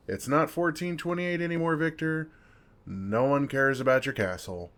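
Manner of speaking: high, loud outburst at Victor; exasperated rant. Real, not acted. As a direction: yell it up high, fed up with him. Ranting at absent Victor.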